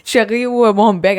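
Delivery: high, loud mock Quebec French; hammed-up French. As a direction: play up a silly French lover voice, laughing. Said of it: "Two sprinters to Quebec".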